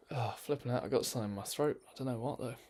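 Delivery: normal talking voice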